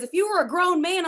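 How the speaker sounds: loud, high mock challenge from a big guy; mock tough husband. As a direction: bark it back like a riled husband. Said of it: "He goes".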